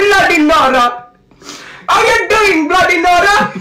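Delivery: very high, loud cartoon king; King Julian. Cartoon king greeting a viewer, shrill comic accent. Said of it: "it's meant to be King Julian".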